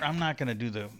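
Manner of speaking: ordinary talk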